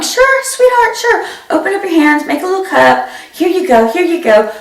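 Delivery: high, sing-song sweet mom voice; sugary mom voice. Retold or imitated. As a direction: coax the child in a syrupy high voice. Mom soothing daughter, high sweet voice.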